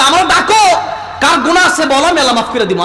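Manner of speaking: ordinary delivery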